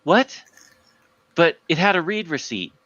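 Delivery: high, disbelieving mock splutter; baffled. The manager stunned that his email was read, incredulous. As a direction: act the boss's lightly hammed confusion. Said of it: "And he's like".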